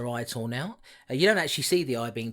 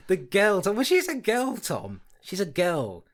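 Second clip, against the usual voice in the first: higher and louder, playfully fed up; mock exasperated; 6 semitones higher, 5 dB louder, slower than usual. Correcting Tom over girl versus child, mock fed up. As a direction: correct him with fake annoyance.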